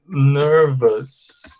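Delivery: NERVOUS very slow, "NUR-vus" with long final S; slow correct pronunciation. Shown as a demonstration. Teacher demonstrates the right pronunciation, very slowly. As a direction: stretch "nervous", reduced second vowel. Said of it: "The pronunciation is like this".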